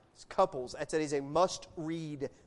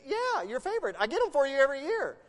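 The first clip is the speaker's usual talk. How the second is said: higher-pitched, cheerfully insistent reply; eager, clueless husband; 6 semitones higher, 6 dB louder than usual. Retold or imitated. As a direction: reassure her brightly, pitch jumping up. Retelling own cheerful reply to wife.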